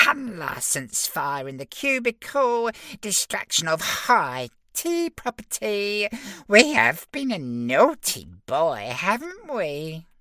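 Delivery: high, drawn-out prissy imp voice; tut-tut like a pompous little official; fussy officious imp. Acted. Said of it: "tutted officiously".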